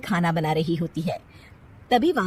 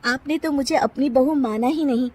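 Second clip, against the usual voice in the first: higher, resentful complaint; bitter daughter-in-law retort; 7 semitones higher than usual. Acted. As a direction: let out years of grievance. (सास को ताना देती बहू)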